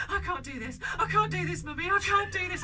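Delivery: high, loud mock panicked wail; panicking child. Mum imitates daughter panicking in bed, mock wail. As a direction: ham up a breathless little girl. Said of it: "she's going".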